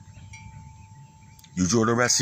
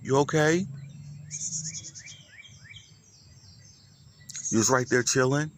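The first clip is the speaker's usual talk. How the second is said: pet talk; 4 semitones higher than usual. Real, not acted. Talking to a lonely kitten.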